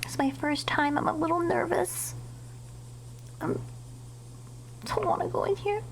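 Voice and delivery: hushed, scared whisper; nervous whisper. Real, not acted. Gamer sneaking into the dark station, nervous whisper. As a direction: breathe it out, tense and quiet.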